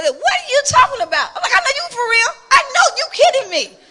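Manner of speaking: bright, incredulous exchange; mock incredulous husband and laughing wife; animated retelling. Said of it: "my husband would do like".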